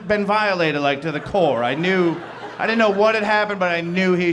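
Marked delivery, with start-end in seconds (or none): none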